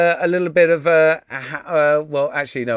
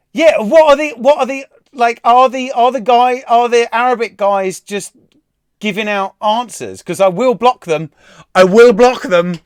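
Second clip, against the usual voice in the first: louder, higher, exasperated challenge; indignant warning; 5 semitones higher, 7 dB louder than usual. Real, not acted. Quiz host to people giving out answers, loud indignant warning. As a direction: lay down the law to the chat.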